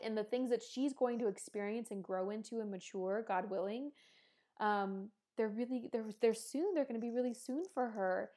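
ordinary talk, nothing marked

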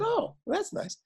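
very high, soft little voice; imitated wife's reply. Wife hears Fosse is coming to dinner, imitated soft reply. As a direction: put on a small, high voice for the wife.

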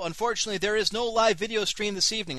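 usual speaking voice

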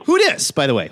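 normal talking voice

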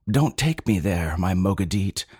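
ordinary delivery, nothing marked

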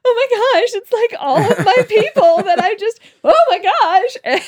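high, loud giggly fan squeal; loud laughing fan excitement; loud starstruck. Retold or imitated. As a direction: gush loudly through laughter. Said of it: "And so I'm being loud".

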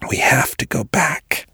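breathy, unvoiced urgent whisper; a faint, desperate whisper. A narrator's reading. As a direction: plead in a broken whisper. Weak man whispering desperately.

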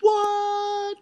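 high, loud shocked what. Real, not acted. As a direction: squeal it in shock. Shocked by petabyte number.

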